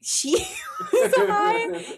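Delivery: high-pitched words broken by laughter; laughing. Real, not acted. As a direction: crack up mid-sentence. Laughs at the question.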